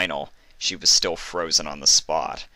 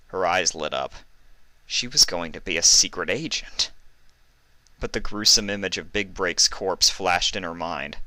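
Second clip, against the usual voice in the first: usual speaking voice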